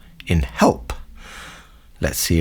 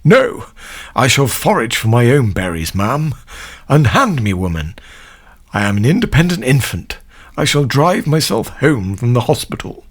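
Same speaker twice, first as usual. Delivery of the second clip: put-on indignant newborn baby; 5 semitones higher than usual. Newborn refusing the bottle from his mother, indignant hammed-up baby voice. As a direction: play an outraged baby refusing all help. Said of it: "I'm picturing him as a newborn baby".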